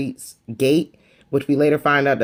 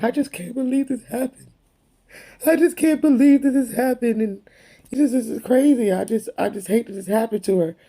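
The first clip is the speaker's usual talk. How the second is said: higher, whiny mock sob voice; hammed-up tearful lament, voice pitched up; mock crying Kyle; 8 semitones higher than usual. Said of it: "Kyle's like".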